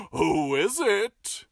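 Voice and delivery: slow, croaky cackling call; cackling wolf. Acted. Wolf calling to the visitor, cackling. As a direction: cackle it through the door. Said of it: "he called in a cackling voice".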